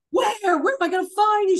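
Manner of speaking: high, loud, fast frantic question; panicked. Retold or imitated. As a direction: ask in a frantic rush. Panicked question to sister-in-law.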